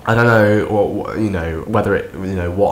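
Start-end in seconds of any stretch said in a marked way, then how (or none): none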